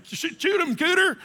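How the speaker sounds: squeaky high hammed-up Southern yell. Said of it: "that kind of stuff".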